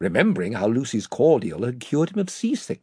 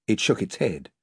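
plain reading voice

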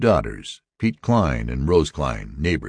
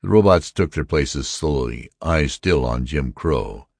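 ordinary delivery

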